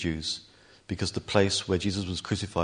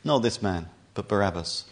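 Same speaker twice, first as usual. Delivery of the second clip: ordinary delivery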